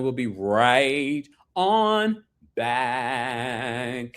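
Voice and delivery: very slow, stretched final words; drawn-out sign-off. Real, not acted. Host sends viewers to the sponsors, playfully drawn out. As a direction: stretch out each word into the break.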